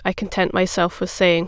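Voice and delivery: ordinary delivery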